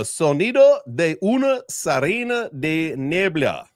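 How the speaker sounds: "Sonido de una Sirena de Niebla" read slowly with Spanish vowels. Shown as a demonstration. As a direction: spell out the Spanish title slowly, Spanish sounds.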